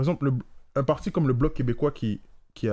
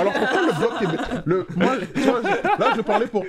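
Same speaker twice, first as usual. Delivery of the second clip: laughing; 8 semitones higher than usual. Real, not acted. Laughing while starting question.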